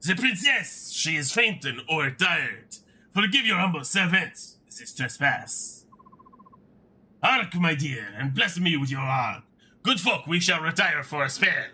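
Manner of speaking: put-on flowery, theatrical French accent. Humble servant fretting over fainting princess, florid French accent. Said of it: "I hate that he's French".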